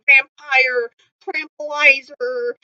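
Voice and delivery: ordinary talk, nothing marked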